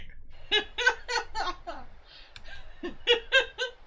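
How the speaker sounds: high, loud burst of laughter. Real, not acted. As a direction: crack up laughing. Laughing at the joke.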